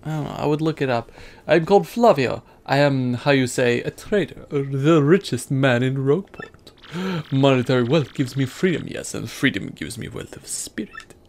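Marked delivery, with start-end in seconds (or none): 1.5-11.1 light put-on Italian accent for Flavio; a hint of a Mediterranean trader accent; Italian-ish accent. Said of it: "Flavio is like a Italian-y".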